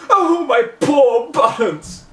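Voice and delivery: loud, high, slow wail. Acted. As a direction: wail out in panic for the lost puppy. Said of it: "started wailing".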